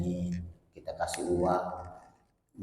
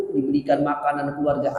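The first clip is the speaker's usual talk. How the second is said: usual speaking voice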